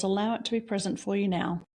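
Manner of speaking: ordinary talk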